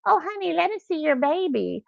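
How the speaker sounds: high-pitched sweet mock coo; cooing elderly lady. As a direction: imitate an old lady fussing over a baby. There were some elderly ladies behind me.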